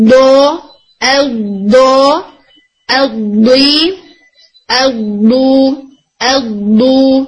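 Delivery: دو said heavy and pressured, tongue sides against the back teeth; letter ض demo. Shown as a demonstration. Teacher showing students the correct ض sound, pressured and heavy. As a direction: repeat دو with a thick, blocked back sound. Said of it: "میں اس کو بول کے ایک دفعہ دکھا دیتی ہوں".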